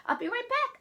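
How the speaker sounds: put-on child's high-pitched parting shout; yelling. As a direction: yell it to uncle as you run off. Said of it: "I yell to Uncle Frankie".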